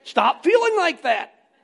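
loud, high, slow command; yelling. Acted. Husband ordering wife's feelings to change, yelling. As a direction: yell it as an absurd order. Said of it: "You're yelling".

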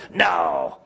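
imitated demon's shout, low and drawn out; demonic voice. Preacher retells the man's sudden second answer, demonic shout. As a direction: boom it like the voice inside a possessed man. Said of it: "This voice came out of him".

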